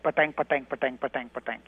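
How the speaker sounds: rhythmic caricature of an Indian accent; mimicked Indian accent. Shown as a demonstration. Actor showing how Westerners hear his accent, mock Indian accent. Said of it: "the way a Westerner hears an Indian accent".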